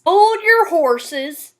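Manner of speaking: slow Southern cowboy drawl with hard R's; masculine Southern cowboy. Shown as a demonstration. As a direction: exaggerate every R like a Southern cowboy. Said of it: "the R's are always exaggerated".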